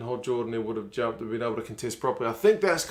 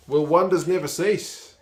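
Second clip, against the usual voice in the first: sarcastic; 6 semitones higher, 7 dB louder than usual. Real, not acted. Sarcastic at rare scrum penalty.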